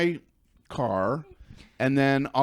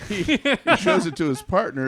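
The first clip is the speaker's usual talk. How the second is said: laughing; 5 semitones higher, 4 dB louder than usual. Real, not acted. Laughing while retelling cop.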